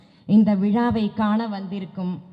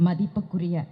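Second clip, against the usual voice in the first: ordinary talk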